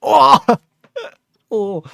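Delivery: high, loud thrilled "oh"; overwhelmed with delight; excited gasp. Real, not acted. Thrilled reaction to viewer's wave.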